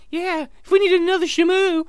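high, loud dolphin aside. Acted. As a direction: sneak in a jab as a squeaky dolphin. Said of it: "you walk up to the dolphins".

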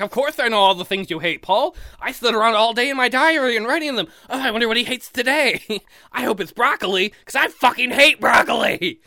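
high, loud sarcastic whine; mocking whiny voice. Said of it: "You're like".

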